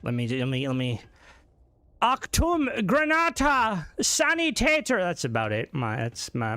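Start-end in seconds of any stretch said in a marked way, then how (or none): 2.0-5.0 high, slow German soldier call-out; mock battlefield warning in German; Call of Duty lines. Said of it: "my Call of Duty lines".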